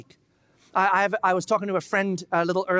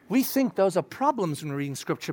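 ordinary delivery

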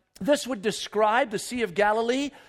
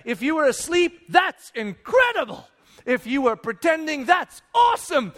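high, loud, jubilant shout; excited shouting; 5 semitones higher, 3 dB louder than usual. Acted. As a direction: cheer both options out loud. Preacher exults either way.

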